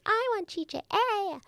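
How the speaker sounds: put-on high, whiny toddler babble; fake baby voice. Mother imitating her son jokingly asking to nurse, fake baby voice. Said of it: "he makes this fake baby voice".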